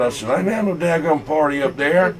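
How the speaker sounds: ordinary talk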